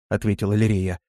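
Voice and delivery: ordinary delivery, nothing marked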